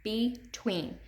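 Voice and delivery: "Between" split into be, then tween; teacher sounding out "Between" in two separate beats; syllable by syllable. Shown as a demonstration. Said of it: "Say it, put your hand under your chin".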